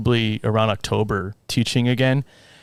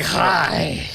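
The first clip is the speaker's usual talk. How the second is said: higher, put-on character greeting; mimicking John; 5 semitones higher than usual. Scare actor greeting a fan at dinner, mimicked character voice. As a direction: greet them in an exaggerated character voice. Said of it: "I'm trying to mimic his voice".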